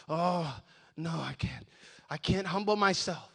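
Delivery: low, soft imitated refusal; half-voiced, put-on reluctance; mock reluctance. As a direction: imitate a proud person shrinking back. Voicing a proud, reluctant churchgoer.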